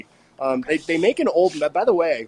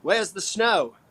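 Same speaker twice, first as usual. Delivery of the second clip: higher, light mock Australian accent; a playful hint of an Australian viewer's accent; Paul Richards, Aussie accent; 5 semitones higher, slower than usual. Host imitates a viewer's chat message in a mild mock Australian accent. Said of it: "that's more of an Australian accent".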